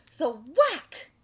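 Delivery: big, explosive sound-effect read; not talking voice. Shown as a demonstration. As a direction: punch "whack" like huge printed letters. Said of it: "we don't say it like I'm talking now".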